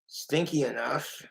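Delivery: ordinary talk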